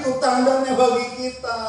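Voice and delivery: high, loud exclamation; excited proclamation. Acted. Jonathan tells his armor bearer of the sign, excited proclamation.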